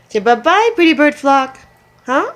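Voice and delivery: high, loud sing-song bye. Real, not acted. Waving bye to the birds.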